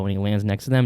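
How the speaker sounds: ordinary delivery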